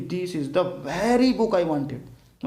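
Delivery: "very" leaned on inside a very slow read; stress on very. Shown as a demonstration. Teacher stressing "very" for students. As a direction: press on "very" and drag the line. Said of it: "book पे जोर दे रहा है कि".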